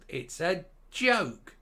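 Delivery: usual speaking voice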